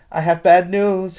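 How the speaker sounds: high and loud, bursting in with news; loud announcement. Acted. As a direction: announce it to the whole room. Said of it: "he announced".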